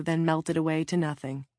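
ordinary delivery

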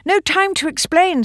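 urgent, high-pitched brush-off; hurried. A narrator's reading. Polly puts off Ben's question, hurriedly. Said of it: "said Polly hurriedly".

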